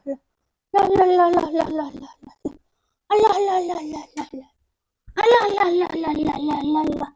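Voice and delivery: sing-song lulling syllables, each run falling away. Real, not acted. Soothing the crying baby. (membujuk bayi yang menangis)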